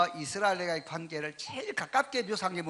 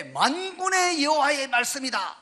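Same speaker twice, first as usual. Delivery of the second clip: high, emphatic declaration; authoritative proclamation; 5 semitones higher, 5 dB louder, slower than usual. Retold or imitated. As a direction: proclaim it with weight, drawing the words out. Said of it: "그 권위가 있고".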